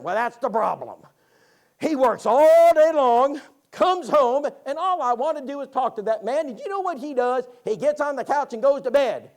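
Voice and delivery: loud, high, drawn-out mock grievance; complaining wife. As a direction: act out a wife's exasperated rant. Retold wife complaining about husband.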